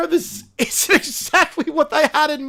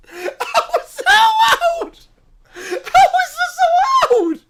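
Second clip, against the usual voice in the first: disbelieving shout; 11 semitones higher than usual. Real, not acted. Player outraged by the game, high laughing shout. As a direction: shriek it in amused disbelief.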